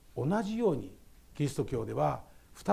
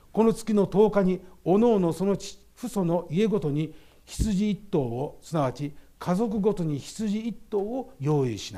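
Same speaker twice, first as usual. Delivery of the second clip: scripture reading; 3 semitones higher, 6 dB louder than usual. Acted. Reads Exodus passage aloud. (出エジプト記を会衆にゆっくり大きな声で朗読する)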